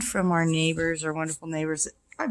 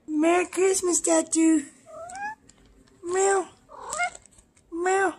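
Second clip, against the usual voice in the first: high pet voice; 13 semitones higher than usual. Real, not acted. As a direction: croon it way up high to the cat. Greeting cat Tattoo.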